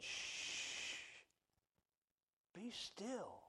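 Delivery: breathy hush, barely voiced; hushed whisper. God hushing his restless people, soft whisper. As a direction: hush them gently, almost no voice. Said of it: "He said".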